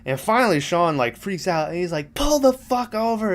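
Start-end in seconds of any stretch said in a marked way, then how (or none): 2.1-3.3 exasperated outburst, pitched up, held back in volume; retold yelling. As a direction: act out freaking out at the driver. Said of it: "and he's yelling".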